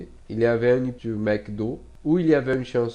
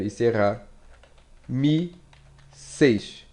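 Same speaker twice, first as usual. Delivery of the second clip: ordinary talk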